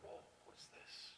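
stunned, slow whisper; awed whisper. Retold or imitated. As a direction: gasp it out under your breath.

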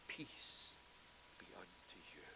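soft breathy peace, barely voiced; a hushed, tender blessing spoken to frightened disciples; gentle whisper. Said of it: "And again he said".

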